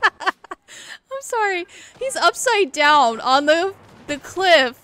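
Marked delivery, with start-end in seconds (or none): 0.0-1.7 real giggles spilling into the apology; slow, high, laugh-choked sorry. Real, not acted. Apologising while cracking up.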